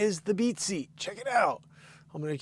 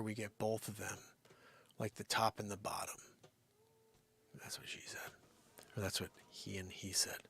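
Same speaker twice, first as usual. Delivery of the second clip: whispering; 9 semitones lower, 16 dB quieter than usual. Real, not acted. Innuendo joke whispered.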